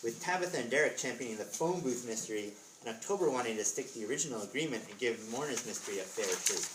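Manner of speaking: plain reading voice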